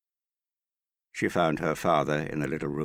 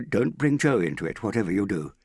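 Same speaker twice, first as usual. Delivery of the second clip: high, louder burst of alarm; alarmed objection; 5 semitones higher, 5 dB louder than usual. A narrator's reading. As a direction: cut in urgently to forbid it. Father alarmed at mention of Joe.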